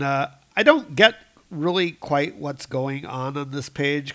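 normal talking voice

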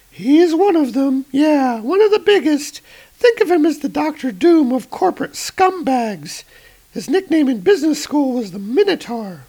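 high, bright agent voice; high female voice. Acted. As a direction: raise the pitch for the woman agent. Female agent answers Thor.

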